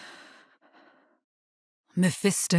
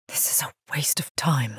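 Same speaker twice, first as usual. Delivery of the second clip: eerie whisper; 4 semitones lower than usual. Acted. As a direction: murmur it like a voice in the head. Whispering voice intrudes.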